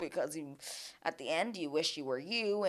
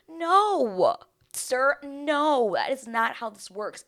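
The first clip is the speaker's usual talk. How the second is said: emphatic no; 8 semitones higher, 5 dB louder, much slower than usual. Acted. Scolding Jeremy, incredulous.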